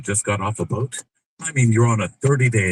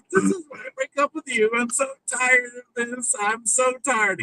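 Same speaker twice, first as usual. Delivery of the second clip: high, weary breakup whine; mock exasperated girlfriend; 14 semitones higher than usual.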